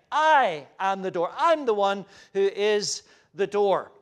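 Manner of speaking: loud, weighty proclamation; emphatic. Retold or imitated. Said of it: "very emphatic".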